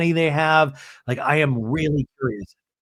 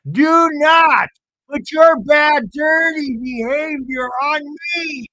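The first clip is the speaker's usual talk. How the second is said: hammed-up high, slow shouting meltdown; Meredith; 11 semitones higher, 6 dB louder, slower than usual. Losing her mind at dinner, high mock shout. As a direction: imitate an unhinged, drawn-out high yell. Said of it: "Meredith is losing her mind".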